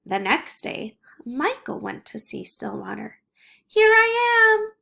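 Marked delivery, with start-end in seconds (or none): 3.7-4.7 high, loud call down from a tree. Acted. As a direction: shout it down to a friend below. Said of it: "Stillwater called from the tree".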